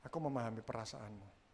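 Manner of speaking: low, soft reassurance; hushed, sincere empathy; understanding husband. Shown as a demonstration. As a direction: murmur it tenderly to a wife. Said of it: "terus suami bilang gini".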